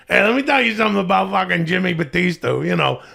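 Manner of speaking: a hammed-up New York accent, quick and pushy; New York guy. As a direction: play it like a brash New York wiseguy. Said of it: "This is me doing a New York guy".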